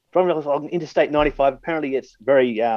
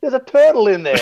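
laughing shout; 12 semitones higher than usual. Retold or imitated. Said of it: "the guy looked at the windshield and goes".

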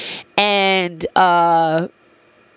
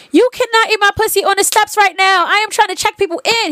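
scolding; 13 semitones higher than usual. Retold or imitated. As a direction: firmly tell him off, pitched way up. Retold scolding the partner.

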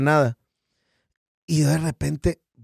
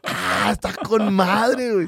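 slow, drawn-out impressed reaction, higher; amused approval; 5 semitones higher, slower than usual. Retold or imitated. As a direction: savor it like you just heard something clever. Reacciona encantado al cierre ajeno. (aprobación divertida y alargada)

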